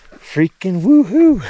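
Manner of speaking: high, loud, drawn-out whoop; excited whoop. Real, not acted. Hiker to camera hoping to see the lake, loud drawn-out whoop. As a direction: let the woohoo ring out slowly, thrilled.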